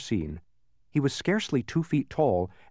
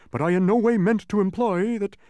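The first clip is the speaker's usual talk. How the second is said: very high, loud protest; insistent humbug; 12 semitones higher, 5 dB louder than usual. A narrator's reading. Humbug objecting to the officer, insistent protest. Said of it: "insisted the humbug".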